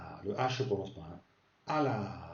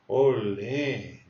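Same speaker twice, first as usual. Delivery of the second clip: exclaimed olé; 6 dB louder than usual. Shown as a demonstration. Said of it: "et tu fais".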